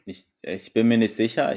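usual speaking voice

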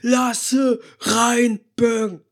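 slow, drawn-out metal growl; metal singer voice. Pretend. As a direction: stretch it out like a metal frontman. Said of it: "Wie so ein Metal-Sänger".

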